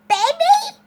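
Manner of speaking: put-on high, loud call for Baby; shouted call. A narrator's reading. Neighbor shouts for missing Baby, loud high call. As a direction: call out sharply, pitch way up. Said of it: "shouted Yesenia".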